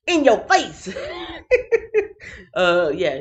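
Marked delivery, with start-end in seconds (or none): 0.1-0.9 gleeful shouted taunt; laughing taunt. Acted. 1.0-2.5 high laugh. Real, not acted. Big laugh.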